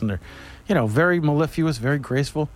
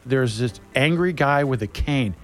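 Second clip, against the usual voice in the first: ordinary talk, nothing marked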